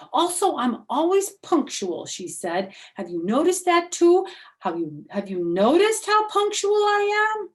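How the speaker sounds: put-on high, loud eager little girl voice; high, bright know-it-all girl; bragging May. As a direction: show off like a know-it-all schoolgirl. May's bragging voice.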